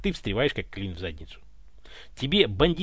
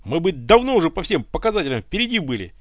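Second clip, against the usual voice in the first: somewhat higher, louder eager praise; sincere admiration; 4 semitones higher, 5 dB louder than usual. A narrator's reading. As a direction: gush over the commander's trick. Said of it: "искренне восхищался Семёнов".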